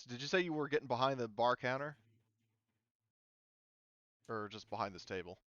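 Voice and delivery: ordinary talk, nothing marked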